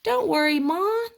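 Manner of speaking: high, loud little piggy voice; bright chorus of piglets reassuring their mother; piglets oinking. Acted. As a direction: squeal it out like eager little pigs. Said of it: "they oinked".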